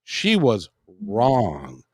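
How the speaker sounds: slow, emphatic self-affirmation; measured and resolute, with weighty pauses; deliberate affirmation. Retold or imitated. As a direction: declare it slowly, word by word. Retold self-affirmation, stated deliberately.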